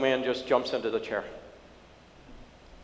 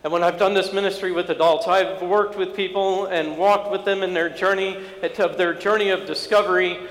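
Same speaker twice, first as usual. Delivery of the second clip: high, insistent sermon voice; impassioned preaching; 6 semitones higher, 6 dB louder than usual. Acted. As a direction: press the point home to the congregation. Preaching the point.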